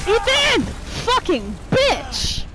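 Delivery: high cry, then slow loud curse; angry shouting. Real, not acted. Player raging mid-fight at Ethan, angry shouting. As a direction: yell the name, stretch the curse.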